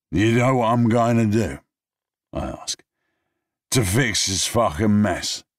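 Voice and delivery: high, loud slurred boast; drunken declaration. Acted. Drunk man announces his plan, loud and high.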